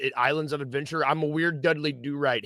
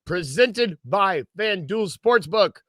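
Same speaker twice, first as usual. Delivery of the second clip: slow, drawn-out hammed-up host welcome; mock radio announcer welcome; 5 semitones higher, 6 dB louder, slower than usual. Host mock-announcing show intro.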